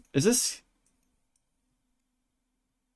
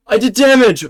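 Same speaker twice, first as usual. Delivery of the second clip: loud, high burst of triumph; excited shout; 6 semitones higher, 11 dB louder than usual. Real, not acted. Streamer yelling at his game after scoring damage, excited shout. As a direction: yell it out, thrilled you scored. Lands damage in ranked duel.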